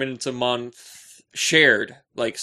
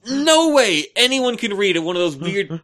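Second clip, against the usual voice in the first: comic rant; 7 semitones higher, 7 dB louder, slower than usual. Acted. Comic rant about tall books.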